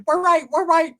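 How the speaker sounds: put-on very high, loud little-kid squeal; high-pitched mocking child voice. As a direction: mimic kids chanting up high.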